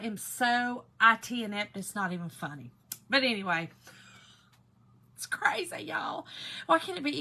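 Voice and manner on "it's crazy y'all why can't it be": whispered aside; breathy whispered remark. Real, not acted.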